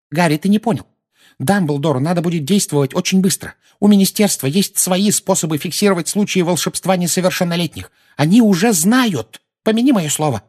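put-on high, insistent old lady; high-pitched anxious warning; agitated old woman. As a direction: warn with fussy urgency.

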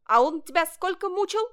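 high, loud magpie challenge; chattering young magpie. A narrator's reading. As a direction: chatter the question like a little bird. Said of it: "застрекотал сорочонок". (высокая громкая трескотня сорочонка)

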